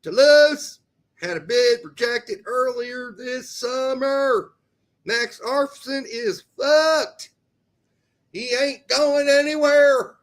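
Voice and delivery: loud, high, punchy news read; news bulletin read. Acted. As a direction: announce it like a breaking sports ticker. Reads transfer news bulletin.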